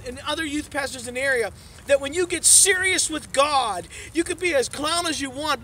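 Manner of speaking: full-force pulpit preaching. Acted. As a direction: drive the message home to the congregation.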